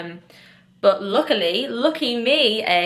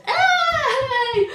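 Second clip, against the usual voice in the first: a loud, high whoop of relief; triumphant cheer; 4 dB louder than usual. Real, not acted. Vlogger cheering after the estate agent lets her extend. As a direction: cheer like you just won.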